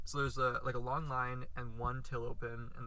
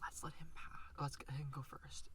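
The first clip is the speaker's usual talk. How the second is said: whisper; 11 dB quieter than usual. Retold or imitated. Lady in line quoted.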